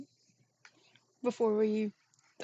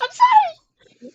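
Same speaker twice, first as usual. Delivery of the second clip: wailing apology; 11 dB louder, much slower than usual. Pretend.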